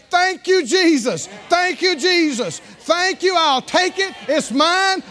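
joyful receiving. Retold or imitated. Preacher voicing a believer joyfully claiming God's gift, building to a shout. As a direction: shout your thanks like a child tearing open a gift. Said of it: "you rip the paper off and you say".

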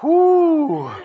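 long, swelling gasps of wonder, acted out; awestruck exclamations. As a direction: act out being overwhelmed by glory. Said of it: "you and I will be in glory with God going".

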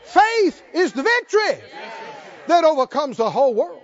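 high, loud proclaiming preacher; full-force preaching. Real, not acted. Pastor proclaiming to the congregation, high and loud. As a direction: declare it to the whole room.

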